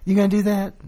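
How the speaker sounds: high-pitched, judging question; condemning. Retold or imitated. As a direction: voice a disapproving paperclip assistant. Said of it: "has condemnation".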